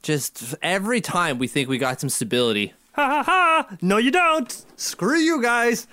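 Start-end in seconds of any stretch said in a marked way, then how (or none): 2.9-5.9 high-pitched gloating villain; put-on high sneering tormentor; mocking taunt. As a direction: fake laugh, then jeer at them. Mocking taunt voiced as fate's reply.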